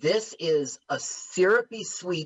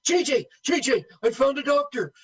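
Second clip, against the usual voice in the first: high excited mock call; imitating Dom DeLuise; 9 semitones higher than usual. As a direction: burst in high and eager. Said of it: "Dom DeLuise goes up and goes".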